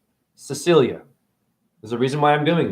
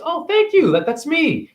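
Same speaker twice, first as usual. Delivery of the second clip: much higher, gushing over a statue of yourself; mock delighted worshipper; 11 semitones higher than usual. Mocking a churchgoer, gushing high at their own statue. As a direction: squeal it like you've just seen your own statue. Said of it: "You just, oh, you just".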